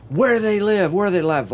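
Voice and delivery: mock the angry husband's outburst; husband yelling. Husband shouting in the background, accusing. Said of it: "You hear the husband in the background".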